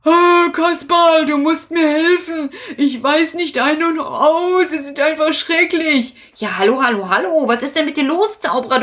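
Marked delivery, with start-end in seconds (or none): 0.1-6.1 high, urgent pleading; agitated wizard. Acted. Wizard begging Kasperl for help, high and frantic. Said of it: "Du bist ja so aufgeregt".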